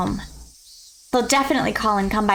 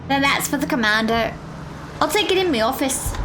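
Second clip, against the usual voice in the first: put-on higher, swooping British-Australian mishmash accent; weird accent; 3 semitones higher than usual. Commander and underling discussing a caller, in a weird accent. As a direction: play phone-call dialogue in a muddled, put-on accent. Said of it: "I'm just giving him a weird accent".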